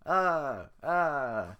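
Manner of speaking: loud scared yells; panicked screams. Acted. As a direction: flee screaming. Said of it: "the men scramble away".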